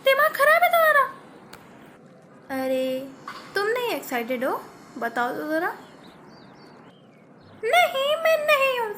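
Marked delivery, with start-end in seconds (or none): 2.5-5.7 much lower, soft teasing voice; teasing elder sister. Acted. Elder sister teasing the younger one, low and playful. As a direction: needle her gently from above.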